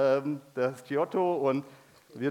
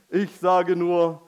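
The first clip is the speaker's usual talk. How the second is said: ordinary talk, nothing marked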